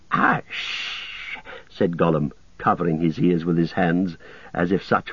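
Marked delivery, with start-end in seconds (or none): 0.1-1.4 sharp breathy hush, much higher; whispered hiss. A narrator's reading. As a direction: Gollum hushing in alarm. Gollum hushing Frodo in alarm.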